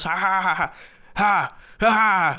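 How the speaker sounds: sarcastic hammed-up imitation laugh; mocking laugh. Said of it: "they start laughing at themselves".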